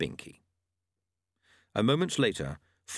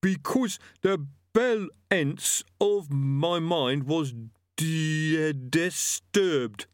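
struggling reader; 7 semitones higher, much slower than usual. A narrator's reading. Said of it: "voice of those to whom the written word is an uphill struggle".

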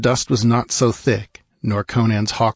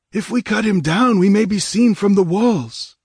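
very high, louder put-on voice; objecting nomad; 12 semitones higher, 4 dB louder than usual. A narrator's reading. Nomad warning his chief about the walls, objecting. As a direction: object in a far higher character voice. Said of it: "objected one of the nomads".

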